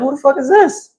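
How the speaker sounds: high incredulous who the fuck is this; mock disbelief at an unknown opponent; retelling his disbelief at the fight card. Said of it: "I was like".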